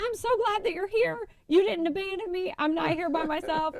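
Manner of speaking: high relieved gush. Retold or imitated. Girlfriend tells boyfriend she's relieved, high gush. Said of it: "I was like".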